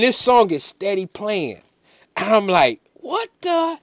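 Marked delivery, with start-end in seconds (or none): 2.9-3.8 high, hushed bewilderment; retold fright in a soft high voice; scared and baffled, pitched way up; freaked out. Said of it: "it freaked me out and scared me".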